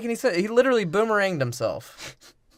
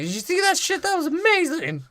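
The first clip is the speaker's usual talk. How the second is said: much higher, goofy mock-excited voice; mocking dumb agent; 12 semitones higher than usual. Movie podcaster mocks the Sector Seven agent, high goofy voice. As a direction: squeak it out like an overexcited dumb agent.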